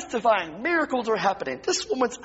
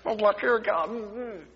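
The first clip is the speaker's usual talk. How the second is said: ordinary talk, nothing marked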